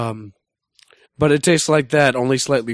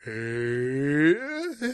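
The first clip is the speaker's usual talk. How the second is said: highest possible pitch. Shown as a demonstration. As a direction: strain upward as far as the voice will go. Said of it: "The highest I can get".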